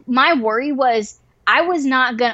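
ordinary delivery

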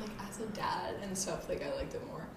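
soft unvoiced whisper. Real, not acted. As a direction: drop to a whisper. Whispered aside.

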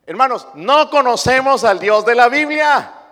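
loud, high, each word hammered; the same line, now thundered from the pulpit; preached out loud. Acted. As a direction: proclaim it to the whole church. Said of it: "pero no conocemos al Dios".

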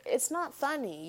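ordinary talk

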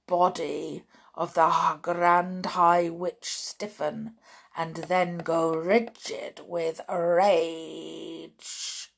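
plain reading voice

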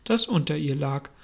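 usual speaking voice

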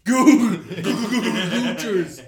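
loud, hammed-up commercial slogan; mock ad jingle. Podcaster improvising a plumbing sponsor spot, mock ad. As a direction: belt out a joke plumbing ad.

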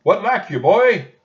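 high, loud hawker's call; shouting shopkeeper. A narrator's reading. Shopkeeper shouting at Jack across the bridge. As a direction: bellow at the passing boy. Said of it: "he shouted".

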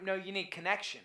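usual speaking voice

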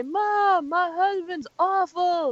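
high, slow whining daughter; whiny complaint.

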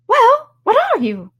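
very high, loud bird squawk; demanding pigeon. Acted. Pigeon pressing Alice to identify herself, demanding. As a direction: screech the question impatiently.

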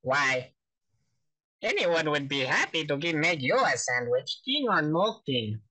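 lilting character impression with big pitch leaps; Mister Lunt. Shown as a demonstration. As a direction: swoop up on each compliment, then settle. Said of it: "go for Mister Lunt".

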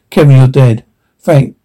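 ordinary talk

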